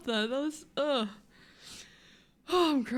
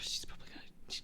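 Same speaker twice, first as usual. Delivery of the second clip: breathy whisper aside; 15 dB quieter, much faster than usual. Real, not acted. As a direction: drop to a whisper, secretive.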